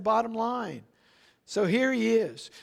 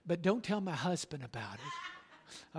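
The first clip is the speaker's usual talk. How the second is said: softer, laughing secretive aside; sly aside; 2 semitones lower, 9 dB quieter than usual. Retold or imitated. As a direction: let it slip through a laugh like a conspirator. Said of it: "she would always add this little thing".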